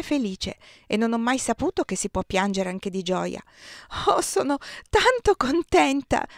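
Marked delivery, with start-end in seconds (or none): 4.1-6.3 much higher, "tanto" cracking with sobs; crying tears of joy; sobbing with joy. A narrator's reading. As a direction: weep happily through the words.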